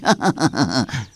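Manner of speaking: low, soft run of laughter; fawning chuckle. Acted. Admirer flattering the long-eared stranger, low chuckling. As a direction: chuckle along between compliments.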